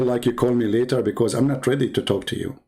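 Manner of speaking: ordinary delivery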